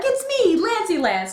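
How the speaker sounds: goofy Lance. Retold or imitated. Fan mimicking Lance greeting the lion, hammed-up goofy. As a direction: sell it like a cheesy charmer. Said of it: "he had to be all funny".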